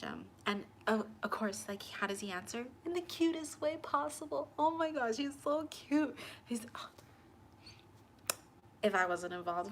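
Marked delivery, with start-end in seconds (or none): 2.9-6.9 gushing. Real, not acted. Gushing to viewers about his cute reply. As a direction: melt over how cute he is.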